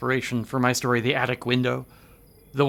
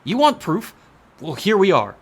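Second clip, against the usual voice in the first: sharp indignant outburst; angry; 5 semitones higher, 7 dB louder than usual. Acted. Carter snapping indignantly at Manton for doubting him. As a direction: cut him off hotly.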